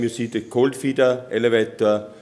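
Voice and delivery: ordinary delivery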